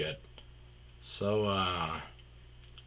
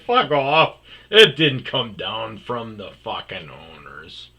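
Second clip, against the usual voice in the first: angry retort; 11 dB louder than usual. Retold or imitated. Retold telling coworker off.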